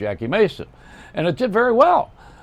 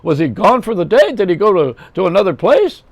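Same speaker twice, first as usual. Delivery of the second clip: much higher, louder mocking comic; high, loud, incredulous comic voice; Jackie Mason; 8 semitones higher, 5 dB louder than usual. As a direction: needle Putin like a sarcastic stand-up. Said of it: "Jackie would have loved that".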